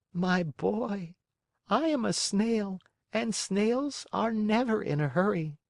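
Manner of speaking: put-on a calm old snail calling down. Snail replies.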